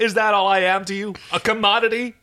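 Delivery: high, wounded indignation; demanding. Acted. John confronts Aaron over his worth, demanding. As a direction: demand an answer, offended. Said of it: "John demanded".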